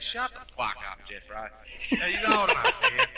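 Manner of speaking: high retold old man snapping; grandpa telling off. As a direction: put the dad in his place as Pop.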